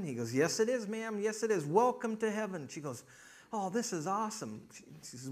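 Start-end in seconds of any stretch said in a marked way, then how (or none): 0.3-2.7 high, lilting greeter's voice; welcoming Peter. Acted. Peter greets the woman at the gates, welcoming. 3.5-4.6 higher-pitched acted delight; delighted newcomer. Woman learns she is in heaven, delighted.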